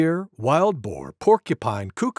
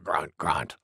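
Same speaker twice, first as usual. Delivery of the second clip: very low, soft grunts; grunting boar; 12 semitones lower, 7 dB quieter than usual. A narrator's reading. Wild Boar after her sneeze, low soft grunting. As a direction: settle into low piggy grunts.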